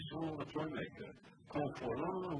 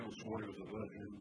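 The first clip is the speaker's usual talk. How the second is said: plain reading voice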